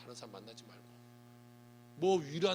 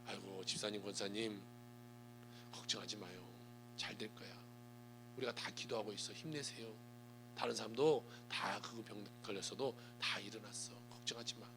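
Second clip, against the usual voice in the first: quiet, gentle reassurance to a sick friend; soft comforting words; 7 semitones lower, 8 dB quieter than usual. Shown as a demonstration. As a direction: soothe a hospital patient softly. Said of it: "이렇게 말해야죠".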